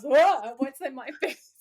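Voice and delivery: loud startled whoa, then a quick mock panic; startled awake. Sam jolted awake by the radio, mock startled. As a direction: jolt awake in a hammed-up fright. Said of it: "jump scares himself awake and he goes".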